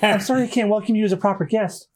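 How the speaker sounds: high, quick apology; lightly voiced mistress of the house; Kaya. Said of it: "Whoops, that was Kaya".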